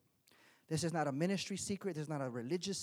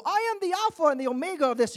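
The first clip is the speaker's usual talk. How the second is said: loud, higher self-important boast; proud husband; 10 semitones higher, 9 dB louder, faster than usual. Retold or imitated. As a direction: puff up and claim authority to the wife. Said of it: "I go".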